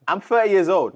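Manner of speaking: high, loud groan-like protest; mock exasperation. Real, not acted. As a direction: complain about turning thirty in jest. Mock lament about age.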